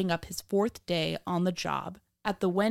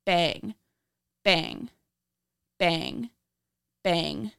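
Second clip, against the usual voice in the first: true crime narrator; 10 semitones higher than usual. A narrator's reading.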